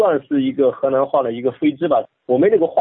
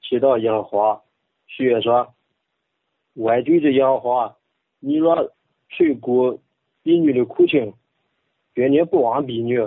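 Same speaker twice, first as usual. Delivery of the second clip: Bible reading in Henan dialect; measured Henan dialect recitation. Shown as a demonstration. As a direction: read scripture in a rustic Henan accent. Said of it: "用河南话".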